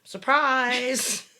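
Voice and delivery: loud, high "surprise!"; mock surprise. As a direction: shout it as a jokey surprise reveal. Playful echo of nice surprise.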